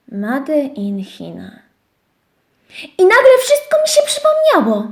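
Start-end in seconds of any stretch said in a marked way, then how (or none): 0.1-1.5 ciche powtórzenie. Shown as a demonstration. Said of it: "Na papierku było napisane".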